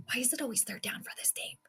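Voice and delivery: hushed, tense whisper. Real, not acted. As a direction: breathe it out in suspense.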